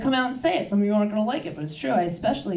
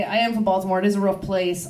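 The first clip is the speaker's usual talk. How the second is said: normal talking voice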